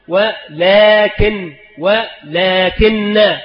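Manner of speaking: ولكن stretched very slowly twice; slow dictation. Shown as a demonstration. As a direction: drag out ولكن as a spelling example. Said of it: "فتحذف الألف". (مد كلمة ولكن ببطء شديد)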